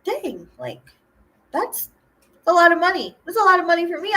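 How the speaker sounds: wide-eyed disbelief at the cash; a retold burst of amazement; amazed. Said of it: "And I was like".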